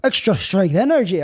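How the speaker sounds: loud, hammed-up announcer voice for the brand name; odd product voice. Host presenting his energy drink, in a loud mock announcer voice. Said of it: "I don't know what that voice is".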